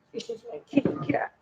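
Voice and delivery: very soft, whispered cooing; whispery pet talk. Real, not acted. Owner introducing her kitty cat to viewers, whispery cooing.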